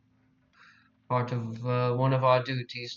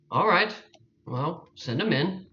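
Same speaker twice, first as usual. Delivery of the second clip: lordly permission; 5 semitones higher than usual. Acted. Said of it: "look at Tannen and say".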